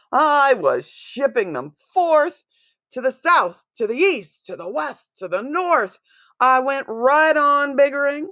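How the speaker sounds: rising, gleeful listing; excited. A narrator's reading. Exultant listing of directions.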